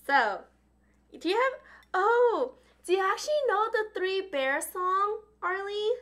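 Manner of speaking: ordinary delivery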